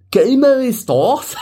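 high, slow, hammed-up English accent in Spanish; badly faked English speaker trying Spanish, half laughing. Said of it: "the Spanish language in an English accent".

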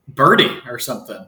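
ordinary delivery

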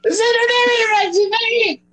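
high fast mocking repeat. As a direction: squeak it back, teasing.

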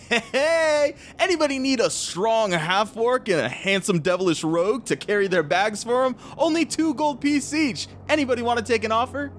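high-pitched hawking to passers-by; cheerful street hawker. Acted. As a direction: call out an offer, drawing out the pitch. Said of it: "Killam pipes up".

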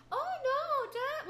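hammed-up high receptionist brush-off; imitating fake secretary. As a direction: play the dismissive receptionist brushing a caller off. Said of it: "And then you're like".

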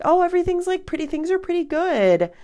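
very high, sing-song false cheer; high-pitched fake-breezy voice; pretending all's well. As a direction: squeak out an unconvincing all-is-fine. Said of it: "when they're not".

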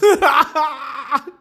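high, loud burst of laughter; laughing fit. Real, not acted. Cracking up at a meme about grass, high helpless laugh. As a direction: lose it laughing.

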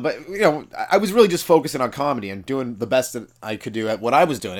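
ordinary talk, nothing marked

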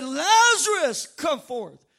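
big slow shout on the name, then easing down; preacher acting out a commanding call; crying out loudly. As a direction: bellow the name, then drop to a firm command. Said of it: "he cried out with a loud voice".

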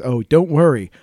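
ordinary delivery

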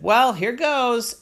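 put-on slow, high hesitant child; nervous little girl. A narrator's reading. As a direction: steel yourself like a nervous kid. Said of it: "Poppy said".

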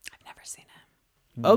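slow sheepish whisper. Real, not acted. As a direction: whisper it like a confession. Confessing not seeing the movie.

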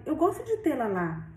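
ordinary delivery, nothing marked

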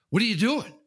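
an alarmed retired neighbor catching him; nosy neighbor. Retold or imitated. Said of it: "they'll come over and they go".

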